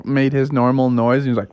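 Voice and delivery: plain reading voice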